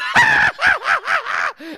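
very high, loud cackling burst; hammed-up helpless laughing fit; uncontrollable laughter. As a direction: laugh like someone being tickled, shrieking. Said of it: "and you're just".

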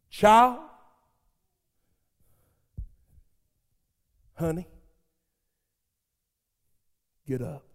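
put-on Jesus gently waking the girl, fading soft; tender wake-up call. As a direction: coax her awake like a sleeping child. Jesus wakes the dead girl.

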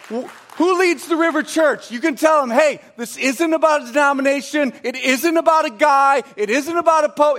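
high, loud, driving sermon voice; fervent preaching. Acted. Pastor urging the church about Jesus, fervent preaching. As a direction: preach it to the rafters.

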